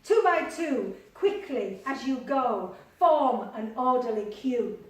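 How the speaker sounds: loud commanding call; booming foreman calling out; bossy ark builder. Acted. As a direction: order the animals into line. Builder calling animals to queue.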